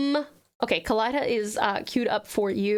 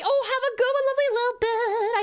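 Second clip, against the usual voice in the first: cheery; 10 semitones higher than usual. Real, not acted. Cheery goodbye to viewer.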